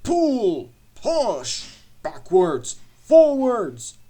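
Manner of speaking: high, loud barked orders; shouted commands. A narrator's reading. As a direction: shout each order as one forceful burst. Engine orders trucks while shunting.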